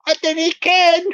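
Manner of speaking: mock Fife accent. [name] answering the scammer, mock Fife accent. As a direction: blurt it in hammed-up Fife Scots. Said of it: "that dinna ken thing from the Fife".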